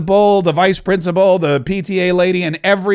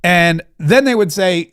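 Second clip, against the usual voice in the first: ordinary delivery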